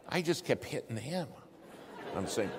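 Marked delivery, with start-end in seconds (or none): none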